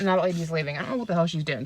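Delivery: ordinary delivery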